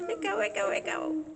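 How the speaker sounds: high voice, repeating like an echo; echo imitation. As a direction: play the tunnel echo back. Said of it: "Does echo".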